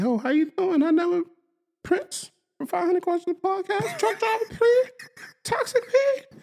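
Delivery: high, airy flirty woman imitation; Halle Berry voice. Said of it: "Halle Berry say".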